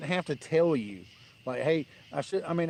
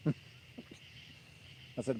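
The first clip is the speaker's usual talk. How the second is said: usual speaking voice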